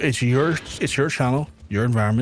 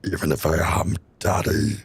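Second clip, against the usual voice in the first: much lower, pulled from the diaphragm; deep chest voice; 6 semitones lower than usual. Shown as a demonstration. Streamer showing chat their deep daddy voice. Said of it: "Yeah, I can still do it".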